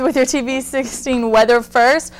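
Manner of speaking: weather report read. Acted. Weather anchor opening read.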